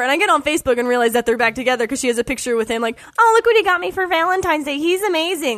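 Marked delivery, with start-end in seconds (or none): 3.2-5.6 mocking girly gush. Mocking the girlfriend's Facebook post.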